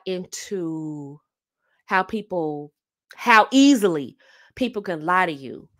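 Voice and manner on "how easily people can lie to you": normal talking voice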